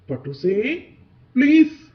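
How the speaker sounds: loud, high-pitched plea; desperate pleading. Acted. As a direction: cry out begging someone not to go. Sister begs kite to stop.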